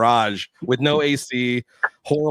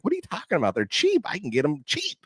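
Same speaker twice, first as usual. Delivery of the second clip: mock buddy voice, much higher, brushing it off; imitating the buddy; 8 semitones higher, 4 dB quieter than usual. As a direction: play him as if it's nothing, pitched way up. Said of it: "He's like".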